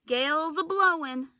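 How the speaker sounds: pirate Pinky warning the crew of the gale, loud and high. A narrator's reading. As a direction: raise it above the howling storm. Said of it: "said Pinky".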